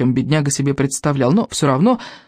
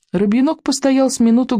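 usual speaking voice

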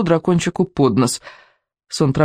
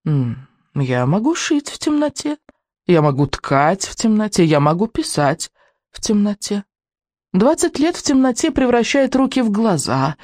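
creepy witch voice; 6 semitones higher than usual. Acted. Witch boasting in the dark.

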